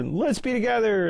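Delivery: high, hammed-up swoon; cheesy romantic. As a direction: gush the line like a cheesy rom-com. Mocking the cheesy closing line.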